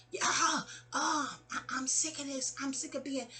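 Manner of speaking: high, softened mock yell; put-on high voice, exasperated; Margot. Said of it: "Margot's yelling".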